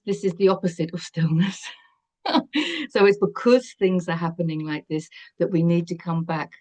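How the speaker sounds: ordinary talk, nothing marked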